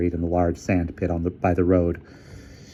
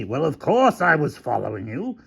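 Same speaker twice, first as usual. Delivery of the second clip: mild bellow, pitched higher; indignant, raised-pitch admission; bellowed retort; 7 semitones higher than usual. Acted. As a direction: throw it back, mildly exasperated. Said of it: "bellowed Hamilton".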